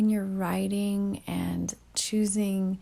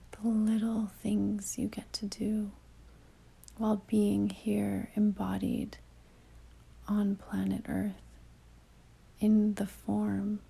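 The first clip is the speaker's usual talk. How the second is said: calm meditation delivery with long pauses; guided meditation; 5 dB quieter than usual. Real, not acted. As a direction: ease the listener inward, leaving long silences between phrases.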